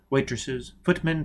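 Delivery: ordinary delivery, nothing marked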